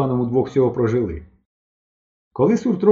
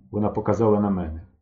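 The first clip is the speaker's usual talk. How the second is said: ordinary delivery, nothing marked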